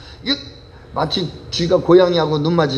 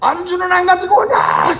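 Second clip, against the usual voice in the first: tyrant shouting; 13 semitones higher than usual. Acted. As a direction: scream at the servant for forgetting the snacks. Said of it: "소리를".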